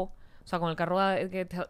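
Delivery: ordinary talk, nothing marked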